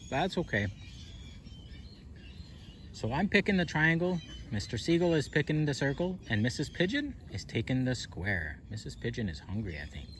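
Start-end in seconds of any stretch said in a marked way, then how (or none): none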